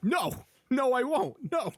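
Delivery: very high, whiny mock "no, I won't"; mock defiant refusal. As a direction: squeak out a childish refusal. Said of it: "He's like".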